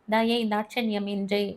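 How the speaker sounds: ordinary delivery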